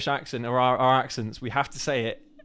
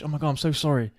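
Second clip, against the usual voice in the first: usual speaking voice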